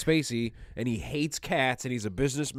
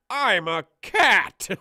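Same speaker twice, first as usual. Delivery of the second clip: higher, louder, very slow dazed reveal; stunned cat realization; 10 semitones higher, 8 dB louder, much slower than usual. Retold or imitated. The man turned cat realizes his fate, in a loud, slow, dazed voice. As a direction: drag out the shock of waking as a cat.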